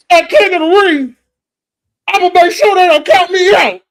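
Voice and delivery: high, loud, shouted trash talk; mock pro-wrestling promo, shouting high and loud; hyped wrestler promo. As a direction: yell it like a wrestler cutting a promo on the mic. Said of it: "Be like".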